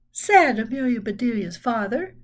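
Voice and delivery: plain reading voice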